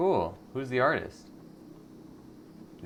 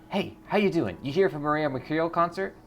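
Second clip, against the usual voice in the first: high, loud eager fan greeting; excited fan; 8 semitones higher, 4 dB louder than usual. Acted. Fan greets a stranger outside the concert, high and eager. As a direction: bounce in like a thrilled fan.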